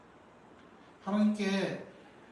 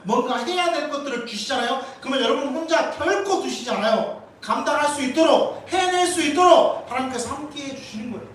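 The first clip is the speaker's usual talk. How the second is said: louder and higher, preaching hard; rising preaching; 5 semitones higher, 7 dB louder than usual. Real, not acted. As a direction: build the promise into a shout. Preacher building to a climax. (점점 높아지는 힘찬 설교)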